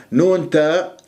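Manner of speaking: normal talking voice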